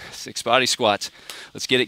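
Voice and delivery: normal talking voice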